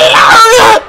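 high shouted call; excited shout. Real, not acted. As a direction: yell the move name. Calling the move.

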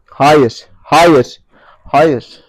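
loud, repeated refusal with falling tune; firm command. Real, not acted. Owner forbidding his horse, firm and loud. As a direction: put your foot down loudly.

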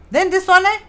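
excited pointing out; sudden burst. Real, not acted. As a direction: jump up suddenly to grab attention. Sudden emphasis in explanation.

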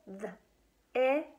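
this split into th- and is; sounding out words. Shown as a demonstration. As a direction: chop this into th-, is. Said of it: "let's get our choppers out".

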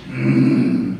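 low, slow growling Grinch. Retold or imitated. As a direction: sneer it like the Grinch. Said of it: "El Grinch".

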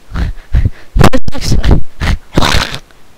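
loud, high, breathless laughter; long laughing fit. Real, not acted. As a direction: lose it completely laughing. Long laugh at game moment.

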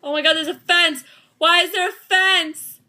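loud, high-pitched panicked yelling; alarmed outcry. Real, not acted. Player stumbles on a fence, alarmed yelling. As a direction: cry out in loud dismay.

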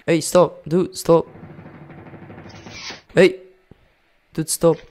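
loud, urgent protest at an opponent; calling out. Real, not acted. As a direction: call out to make them stop.

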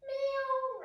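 high playful meow; a high kitty meow for the kids; playful high-pitched cat meow. Shown as a demonstration. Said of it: "What does cat say?".

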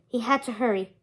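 plain reading voice

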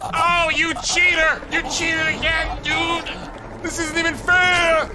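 loud, high, furious complaining; sore loser. Real, not acted. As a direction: yell at the cheating game. Complains ghosts cheated him.

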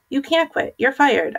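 high-pitched, restrained narrated yell; yelling back. A narrator's reading. Boy sacks his friend who is quitting, mildly yelling. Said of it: "I yelled back".

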